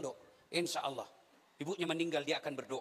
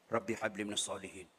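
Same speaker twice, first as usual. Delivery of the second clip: low, soft murmured prayer; whispered prayer; 9 semitones lower, 5 dB quieter than usual. Retold or imitated. Uncle whispers prayer to his nephew on the field, softly. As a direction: murmur it close to the ear. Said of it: "Saya bisik telinganya".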